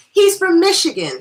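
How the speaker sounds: loud, high, eager announcement; announcing news. Acted. Guest reporting stranger's origin to hostess, excited announcement. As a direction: proclaim it to the friend coming in.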